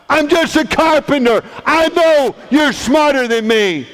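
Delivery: high, forceful protest; exasperated outburst. Acted. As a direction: shout it in exasperated disbelief. Joseph's exasperation erupts.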